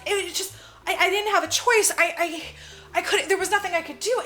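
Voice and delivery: high, loud, stammering mock panic; hammed-up desperate excuse; acted distraught plea. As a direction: stumble over it, imitating a frantic girl. Retelling bloodied girl explaining herself.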